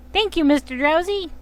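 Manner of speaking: high, chirpy thank-you; grateful kid voice. Acted. Small Pokemon thanking Drowsy, high and chirpy. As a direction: squeak it out like a small child.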